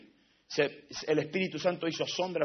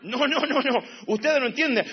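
ordinary delivery

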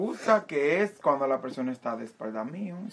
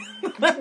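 high laugh; 14 semitones higher than usual. Real, not acted. Friends laughing at memory.

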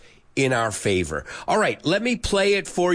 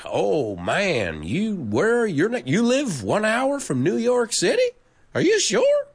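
higher-pitched incredulous questioning; mock disbelief; 7 semitones higher than usual. Teacher recalling his reaction to locals' accents, mock incredulous. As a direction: climb higher into teasing disbelief. Teasing dad's neighbours about their accent.

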